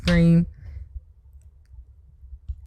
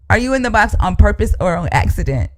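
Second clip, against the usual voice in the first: ordinary talk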